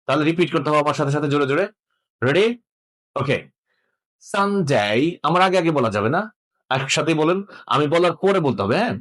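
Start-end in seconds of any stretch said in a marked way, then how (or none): 4.2-5.2 সানডে with a long gliding "ay" in ডে; posh pronunciation. Shown as a demonstration. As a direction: draw out "san-dey", tune dipping then rising. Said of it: "ফস উচ্চারণটা আমরা শিখে নিলে".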